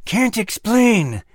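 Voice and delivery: high, slow, words forced through clenched teeth; through gritted teeth. Acted. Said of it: "the lizard answered through gritted teeth".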